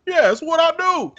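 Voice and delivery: high laughing mock Obama boast; hammed-up cocky brag through a laugh; imitating Obama. Obama brushing off the shot, laughing cocky brag. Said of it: "he was like".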